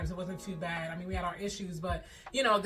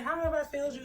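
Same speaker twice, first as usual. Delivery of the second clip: higher hurt mom voice; upset mom; 7 semitones higher than usual. Retold or imitated. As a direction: ask it like a wounded mother. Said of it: "She was like".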